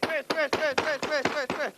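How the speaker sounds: high imitated crash sounds; crash noises. Crashing the model.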